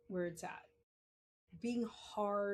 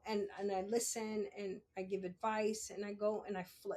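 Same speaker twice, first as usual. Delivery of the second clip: ordinary talk, nothing marked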